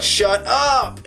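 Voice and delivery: sharp, high angry snap; angry retort. Acted. Peter snapping at the Goblin, high and angry. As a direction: cut him off hard.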